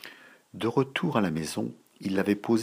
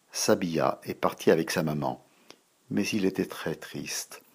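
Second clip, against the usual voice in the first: ordinary delivery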